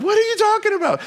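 very high disbelieving squeak; baffled, pitch shooting up; incredulous disciples. Retold or imitated. Other disciples doubting Peter, high incredulous squeal. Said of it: "the other ones are like".

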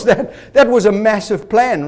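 ordinary talk

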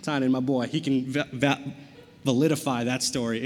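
usual speaking voice

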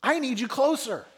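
high, loud cry out to God; anguished plea. Acted. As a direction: call out to someone far away, pleading. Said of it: "you feel like the psalmist".

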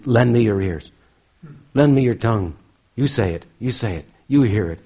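acted plea, loudly called out; loud imitated entreaty; urgent appeal. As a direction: implore the listeners with punched openings. Acts out guru's urgent appeal.

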